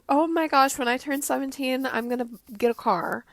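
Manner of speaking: ordinary talk, nothing marked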